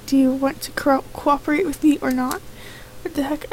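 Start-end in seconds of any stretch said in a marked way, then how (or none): none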